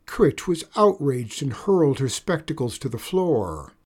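ordinary delivery

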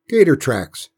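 aunt's emphatic contradiction; insistent. A narrator's reading. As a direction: overrule the boy firmly. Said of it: "Aunt Bess insisted".

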